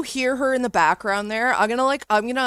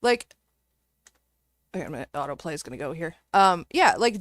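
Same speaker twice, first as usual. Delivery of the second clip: ordinary talk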